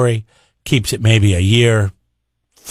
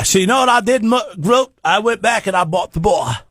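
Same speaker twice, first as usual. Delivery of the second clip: high put-on Irish accent that slips Australian; a mock Irish brogue landing Aussie; attempted Irish accent; 10 semitones higher than usual. Irish accent attempt, friend hears Australian. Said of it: "He's Australian now?".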